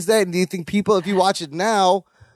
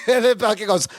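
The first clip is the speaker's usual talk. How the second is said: usual speaking voice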